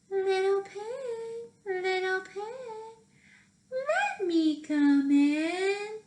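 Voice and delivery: high, slow, sing-song wolf calling at the door; sly wolf. Acted. Wolf calls sweetly to the little pig, high and sing-song. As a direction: coax him out with a lilting high voice.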